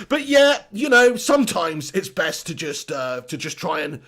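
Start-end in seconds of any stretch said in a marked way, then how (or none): none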